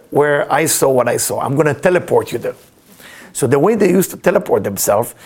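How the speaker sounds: ordinary talk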